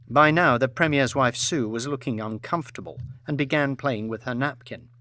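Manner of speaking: usual speaking voice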